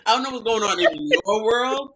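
hammed-up careless fiancé; mock Ian. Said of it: "I'm actually fiancé".

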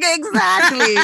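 cracking up as she agrees; amused, laughing agreement; laughing "exactly". Retold or imitated. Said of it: "And I was like".

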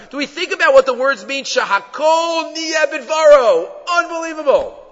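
high, ringing amazement; impassioned awe. Real, not acted. Teacher marveling at the words of a blessing, impassioned awe. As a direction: proclaim it in wonder, drawing out the Hebrew.

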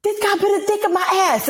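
very high-pitched mock outcry; agitated complainant. Man running up to the officer's car, agitated. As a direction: imitate an upset man blurting it out high. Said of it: "he keeps repeating it louder and louder".

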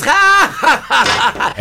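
high hearty fake laughter; hammed-up boastful laugh; mock laugh. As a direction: laugh it up like bragging to a buddy. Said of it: "nos robábamos las palomitas".

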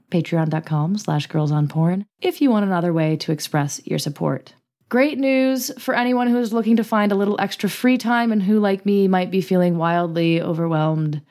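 friendly podcast sponsor read; host reading the sponsor spot. Acted. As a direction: sell it casually, like a host ad.

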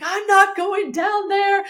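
very high, slow tearful whine; mock sobbing refusal. Student refuses to face her teacher, high mock wail. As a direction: dig in like a wailing child, hammed-up. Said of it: "I was sobbing".

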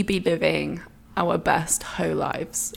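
whisper. Real, not acted. Whispered question, unvoiced.

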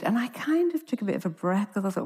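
ordinary talk, nothing marked

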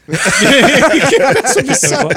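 repeated through a loud laugh. Retold or imitated. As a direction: chuckle out the comeback again. Repeating the comeback while laughing.

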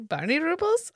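high, hammed-up Russian quip; mock Russian accent. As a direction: repeat the joke in a silly accent. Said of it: "laughing quote".

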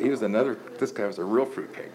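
ordinary talk, nothing marked

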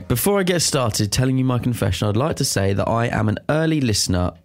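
ordinary talk, nothing marked

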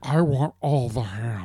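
slow, put-on big bear; fat bear voice. Co-host mocking the host's voice, fat bear. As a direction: draw it out like a greedy cartoon bear.

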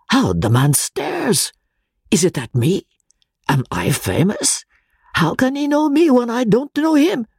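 high, emphatic little professor; Pesca, excited Italian. A narrator's reading. Pesca denies knowing the man, high and emphatic. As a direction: protest it, high and eager.

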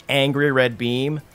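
ordinary delivery

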